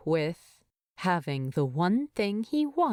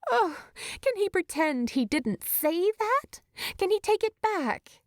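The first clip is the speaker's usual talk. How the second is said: higher, rushed, mortified; panicked inner voice; 12 semitones higher than usual. A narrator's reading. Bilbo regretting his words, a panicked higher inner voice. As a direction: fret that you said too much.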